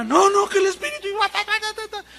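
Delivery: very high, loud frantic babble; tongues babble. Retold or imitated. Preacher mimics the woman resuming tongues, very high babble. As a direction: burst back into tongues, pitched high.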